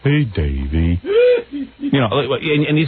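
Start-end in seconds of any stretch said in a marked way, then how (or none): 0.0-1.0 low, drawn-out cartoon sidekick voice; Goliath. Host comparing the guest to Davey and Goliath, low cartoon drone. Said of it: "Davey and Goliath".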